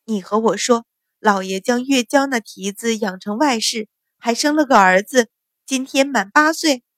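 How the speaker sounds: usual speaking voice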